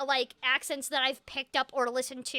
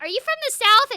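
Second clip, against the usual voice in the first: much higher, louder retold question; startled question; 10 semitones higher, 9 dB louder, faster than usual. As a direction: imitate a director asking in surprise.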